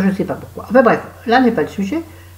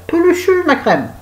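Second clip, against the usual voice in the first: much higher, mock-squealed; squeaky disgust; 11 semitones higher than usual. Real, not acted. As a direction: squeal it, grossed out. Repeating word in disgust.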